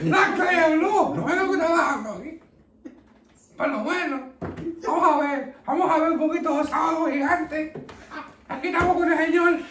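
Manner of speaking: high-pitched, hammed-up Spanish character voice; housekeeper. Housekeeper settling down to watch TV, high put-on voice.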